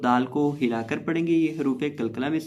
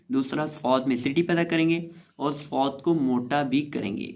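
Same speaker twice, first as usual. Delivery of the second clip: normal talking voice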